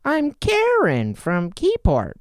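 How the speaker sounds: hammed-up caller introducing herself; caller impression. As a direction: drag it out like a radio caller. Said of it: "They would go".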